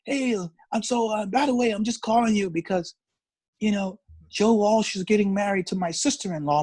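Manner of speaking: high-pitched imitated English caller. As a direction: hold a high, put-on English voice throughout. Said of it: "[name] was like".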